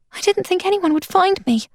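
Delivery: muffled voice from inside a trunk. A narrator's reading. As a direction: sound muffled as if through wood. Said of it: "her voice muffled".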